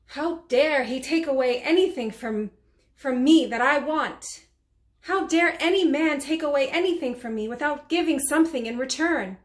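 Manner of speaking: affronted, demand how anyone dares; indignant outrage. Acted. Mary Magdalene confronts the man who took her admirer, indignant. Acted monologue, outrage at the preacher.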